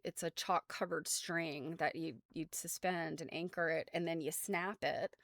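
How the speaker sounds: ordinary talk, nothing marked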